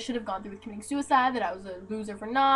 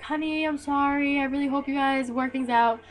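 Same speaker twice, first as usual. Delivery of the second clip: imitated consoling mom; 4 semitones higher than usual. Ex's mom texting her sorry, imitated consoling voice. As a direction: stretch it like a mock-sympathetic mother.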